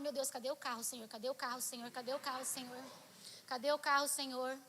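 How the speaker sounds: low, soft repeated pleading; hushed, insistent retold prayer; muttered prayer. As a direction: murmur to God over and over.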